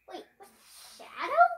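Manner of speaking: sudden scared high shout; startled shriek. Acted. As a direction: yelp at the looming shadow. Sudden shadow appears.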